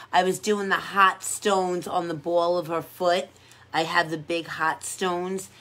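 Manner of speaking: ordinary talk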